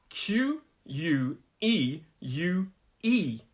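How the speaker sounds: very slow, letter by letter; spelling out. Shown as a demonstration. Teacher shows how the word is written, slow spelling. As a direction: spell Q U E U E with space between letters. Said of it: "the way it's written".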